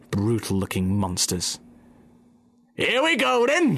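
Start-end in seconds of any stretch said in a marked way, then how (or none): 2.7-3.8 high, loud battle cry; much higher gleeful shout. Acted. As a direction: roar it as the machines start. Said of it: "shouted Bean".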